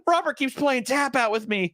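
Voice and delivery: lightly voiced tattling kid; whiny child. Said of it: "child complaining about doll".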